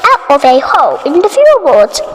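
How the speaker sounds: usual speaking voice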